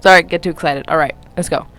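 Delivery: ordinary delivery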